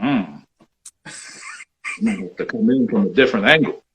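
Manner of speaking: muffled behind a mask; behind welding mask. Real, not acted. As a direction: speak through a welding mask. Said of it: "see if I can play on with the mask on".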